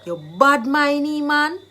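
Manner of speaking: drawn-out, emphatic call-out; accusing, jealousy charge. Shown as a demonstration. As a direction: lean hard on "bad mind". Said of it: "You are just jealous of me".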